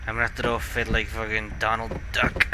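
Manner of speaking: ordinary delivery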